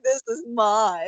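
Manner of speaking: slow, deliberate claim; dramatic Loki. Pretend. Loki stepping through the portal, slow grand claim. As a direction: draw out each word grandly. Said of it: "he's like".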